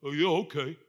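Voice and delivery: low, hesitant, clueless agreement; dopey fellas. Acted. Men agreeing to follow a stranger, dopey hesitant assent. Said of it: "And the fellas are like".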